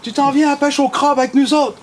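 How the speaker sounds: high, pushy call to come crab fishing; hammed-up local grabbing your shoulder; insistent Gaspésien buddy. Fisherman insisting the singer joins the crab trip, high and pushy. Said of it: "une main ... qui dit".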